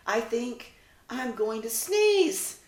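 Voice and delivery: slow, rising alarm before a sneeze; exclaiming. Acted. Izzy the puppy with a bee on her nose, exclaiming. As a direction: drag it out, the sneeze coming. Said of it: "Izzy exclaimed".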